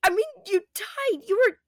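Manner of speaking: high, loud shouting protest, slowing at the end; loud tearful protest. Acted. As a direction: shout at him, relieved and furious. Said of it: "Nora protested".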